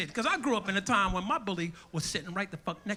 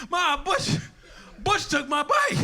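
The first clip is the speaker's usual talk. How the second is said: put-on kid complaining; 8 semitones higher, 4 dB louder, slower than usual. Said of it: "I said to Mama".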